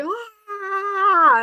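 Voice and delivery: high squealed wail mimicking his outburst; short mock yell, hammed up; mock tantrum cry. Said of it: "And he's like".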